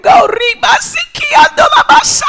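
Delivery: put-on loud, high-pitched child's tantrum cry; imitated wail spilling into tongues; mock wailing. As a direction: bawl it out like a frantic little girl. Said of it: "she started to cry".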